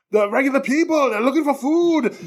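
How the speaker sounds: high, hammed-up action-hero protest; Ben Richards. Host retells the pilot refusing orders, hammed-up hero voice. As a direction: object in a mock movie-star voice. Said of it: "Ben Richards is like".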